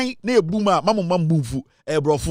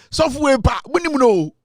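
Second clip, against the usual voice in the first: excited call; 8 semitones higher, 3 dB louder than usual. Real, not acted. Excited calling out the pastor.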